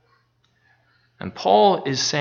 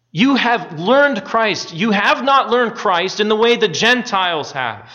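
emphatic preaching; 4 semitones higher than usual. Acted. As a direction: press the contrast home with conviction. Preaching builds again with emphasis.

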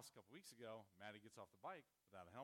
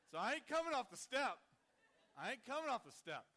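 much higher and louder, defiant; loud emphatic; 12 semitones higher, 14 dB louder than usual. Retold or imitated. Father towering over the boyfriend, shouting defiantly. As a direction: plant your feet and shout it.

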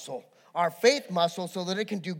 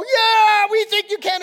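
very high, loud mock crowd roar; imitating fans screaming their approval; crowd going wild; 14 semitones higher, 11 dB louder, slower than usual. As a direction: cheer wildly with a long yelled yeah. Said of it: "they would go wild".

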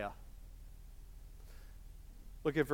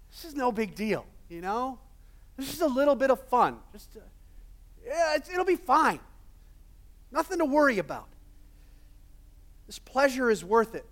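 self-deceiving inner voice; 6 semitones higher than usual. Acted. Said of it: "lying to ourselves".

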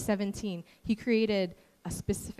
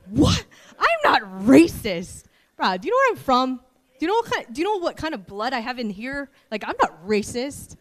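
indignant denial; 4 semitones higher, 10 dB louder than usual. Retold or imitated. Indignant denial of being racist.

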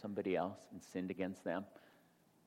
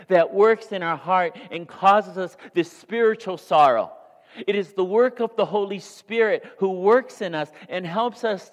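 higher and louder, driving sermon climax; full-force preaching; 9 semitones higher, 12 dB louder than usual. Acted. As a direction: preach it out to the back row.

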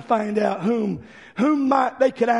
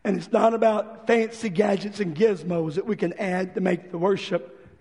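softer, lower sincere preaching; soft earnest appeal; 4 semitones lower, 4 dB quieter than usual. Acted. Preacher quietly urging the church, soft sincere appeal. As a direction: bring it down and speak from the heart.

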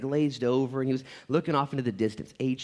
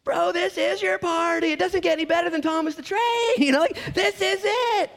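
much higher, loud disbelieving shout; incredulous exclaiming; 6 dB louder than usual. Retold or imitated. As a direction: yell it as if nothing could top this party. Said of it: "We're like".

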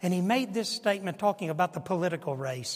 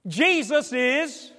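defiant declaration; 7 semitones higher, 7 dB louder, much slower than usual. Retold or imitated. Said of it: "That was convicting".

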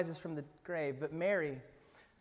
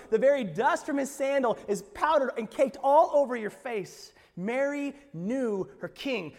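full-force preaching; 7 semitones higher, 9 dB louder than usual. Acted. Pastor proclaiming Mary's recognition, loud and fervent. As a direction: proclaim it to the rafters.

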